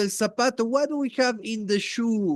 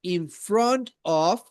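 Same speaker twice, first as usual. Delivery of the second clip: put-on slow, clear modelling for a child; slow model phrase; 3 dB louder, slower than usual. Shown as a demonstration. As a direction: say it slowly for a learner to copy. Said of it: "Repeat after me".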